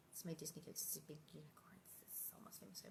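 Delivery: soft whispered show-and-tell; hushed, delighted whisper. Real, not acted. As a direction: whisper it like a secret.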